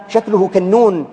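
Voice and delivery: ordinary talk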